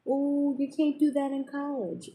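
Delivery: high-pitched mimicking voice; mocking household critics. Quoting judgmental people at home.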